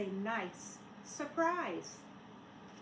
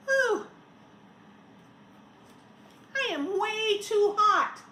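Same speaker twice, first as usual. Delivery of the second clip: loud, high hot complaint; overheated snowman; 8 semitones higher, 9 dB louder than usual. Acted. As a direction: moan about the heat.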